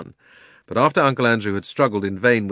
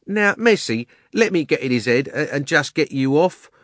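high, soothing, coaxing; coaxing Cockney cabby; 10 semitones higher, 5 dB louder than usual. Acted. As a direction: talk the lady down from the horse. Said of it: "in a not unkindly voice".

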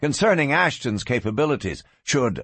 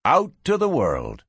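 usual speaking voice

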